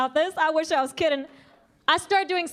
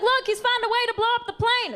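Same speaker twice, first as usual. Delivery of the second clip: mock panicked passenger; 9 semitones higher than usual. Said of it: "She's like".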